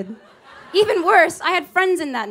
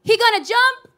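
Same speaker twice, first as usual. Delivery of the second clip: alarmed question; 8 semitones higher, 3 dB louder than usual. Retold or imitated. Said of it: "a student from the balcony was like".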